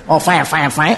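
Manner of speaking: put-on "fine, fine, fine" said low and soft in a lip-only English accent, with a laugh; a laughing, lazy send-up of an English accent; mock English speaker. Preacher teases English speakers for only using their lips, in a low laughing English accent. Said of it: "Dia main sini je".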